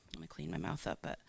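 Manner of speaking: whispered aside to the crowd. Real, not acted. To the congregation, a whispered aside before swearing.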